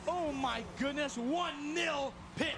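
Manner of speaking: slow, high amazed shout; stunned shout. Real, not acted. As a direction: shout in disbelief, stretching the words. After goal call.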